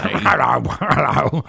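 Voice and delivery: high, loud puppet voice; squeaky loud Zippy greeting; Zippy impression. Friend greeting the hosts as Zippy the puppet. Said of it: "I love doing Zippy impressions".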